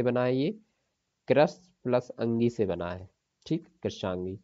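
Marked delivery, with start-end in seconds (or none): none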